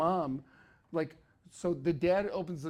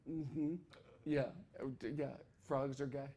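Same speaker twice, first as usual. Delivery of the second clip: soft, lower, knowing; knowing hush; 3 semitones lower, 9 dB quieter than usual. Retold or imitated. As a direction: nod along with a secret. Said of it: "He goes".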